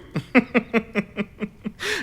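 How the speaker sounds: short, high incredulous laugh; scornful laugh. Acted. Vampa scoffs at the Count's claim of insult. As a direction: laugh off the very idea.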